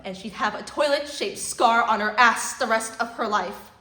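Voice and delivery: loud, building fury; rising anger. Acted. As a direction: let the rage build to the end. Rant builds to a climax.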